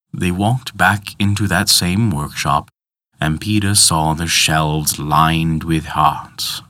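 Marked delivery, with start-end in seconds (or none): none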